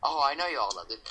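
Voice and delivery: plain reading voice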